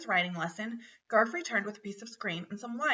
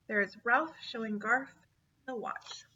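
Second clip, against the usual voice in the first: ordinary delivery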